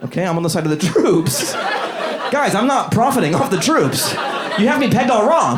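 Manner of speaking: high, pleading protest; mock-wounded protest to the crowd; defensive plea. Real, not acted. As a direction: insist on your innocence, voice climbing.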